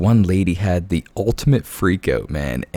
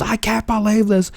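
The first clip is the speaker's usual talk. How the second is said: high squealing mock fury; screechy mock tantrum; mock outrage; 12 semitones higher than usual. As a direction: ham up a customer losing it. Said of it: "she goes".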